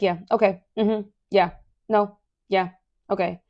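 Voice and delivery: mock impatient string of short replies; dismissive brush-off. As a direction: imitate a pushy middle schooler brushing someone off. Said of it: "pushing me out the door".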